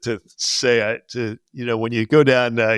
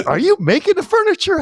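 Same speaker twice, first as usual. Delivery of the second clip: much higher, alarmed teacher; anxious teacher question. Retold or imitated. Worried teacher asking about his work, much higher. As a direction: shoot the question up high in alarm. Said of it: "he goes".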